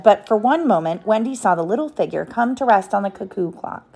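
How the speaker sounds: ordinary delivery, nothing marked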